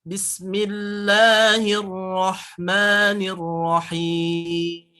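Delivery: slow chant ending Bismillahirrahmanirrahim on a tight mim; slow recitation with a locked final mim; closed mim. Shown as a demonstration. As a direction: lock the final mim at once. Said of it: "Langsung angkep".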